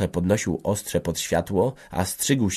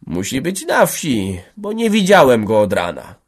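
drawn-out, higher-pitched answer; slow reply; 4 semitones higher, 6 dB louder than usual. A narrator's reading. Antek answering the officials about Rocho, deliberately slow. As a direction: answer slowly, stretching the words. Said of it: "odrzekł wolno".

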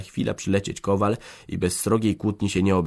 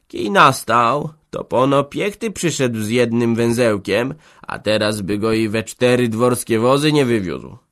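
a raised, lightly voiced old man explaining; 6 semitones higher, 7 dB louder than usual. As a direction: defend him in a put-on old man's voice. Jambroży defends the organist.

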